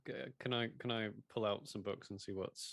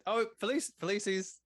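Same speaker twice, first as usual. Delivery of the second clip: high, loud sudden realization; oh, there it is; surprised; 10 semitones higher, 6 dB louder, slower than usual. Real, not acted. Surprised, remembers the familiar.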